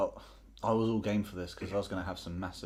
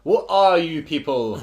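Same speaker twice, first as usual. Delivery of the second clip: loud, high, drawn-out question; mock outcry; 7 semitones higher, 13 dB louder, much slower than usual. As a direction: cry it out slowly, much louder and higher. Mock-bewildered outburst in the game.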